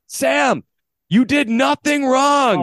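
high, long-drawn holler; loud carrying shout, every word stretched; yelling across diamond. Retold or imitated. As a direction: call out to a kid far across the field. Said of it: "so I'm yelling across the diamond".

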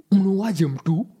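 usual speaking voice

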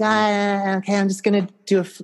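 high, fast camp voice; high, fast camp gush; mock camp gush. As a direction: ham up a camp queen. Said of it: "You know".

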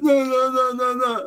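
high repeated no; mock judge rejecting. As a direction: wave them off, high and mocking. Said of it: "just be like".

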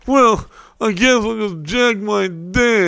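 high, slow, drawn-out self-pity; mock guilt-tripping. Guilting a girlfriend, mock self-pitying whine. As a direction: sulk it out to make them feel bad. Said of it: "guilt your girl into a blowjob".

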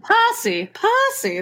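"Percy" said high, with an open "pah" vowel; playful stage-British "Percy"; mock British Percy. Shown as a demonstration. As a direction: pitch "Percy" up and drop the r. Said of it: "that sounds like".

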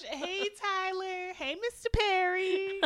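very high, drawn-out chirpy hello; mock phone greeting. Co-host pretending to ring Tyler Perry, high gushing greeting. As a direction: playfully pester him in a high voice.

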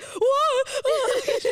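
high, loud imitated "whoa"; laughing impression of an alarmed yell; partner's alarmed voice. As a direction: mimic his startled shout, breaking into laughter. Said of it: "he's a voice, you know".

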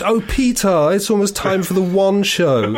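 high, lilting mock wife calling to her husband; put-on high housewife voice; upper-middle-class wife. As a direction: trill it out as a caricature housewife. Voicing the wife in imagined scene.

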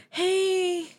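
high mocking voice of a preachy drunk; high mock of a drunk guest starting a debate; mock party bore. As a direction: parody an earnest drunk raising politics. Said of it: "I'm not coming in like".

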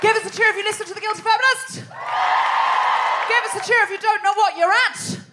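high, projected call to the audience; rousing stage MC; crowd-rallying host. Real, not acted. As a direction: call out for a cheer.

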